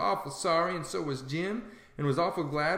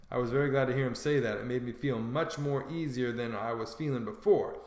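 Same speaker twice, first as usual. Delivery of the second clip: usual speaking voice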